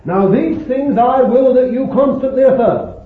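high, loud, insistent proclamation; impassioned declaration. Retold or imitated. Preacher voices Paul going on after his cry, impassioned. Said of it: "and then go on and say".

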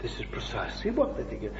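fast, unvoiced confiding whisper. Real, not acted. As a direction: lean in and whisper it quickly. Confiding answer to the doubter.

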